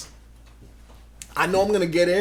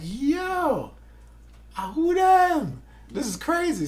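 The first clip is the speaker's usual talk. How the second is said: amazed; 10 semitones higher, slower than usual. Retold or imitated. Amazed at the audition line.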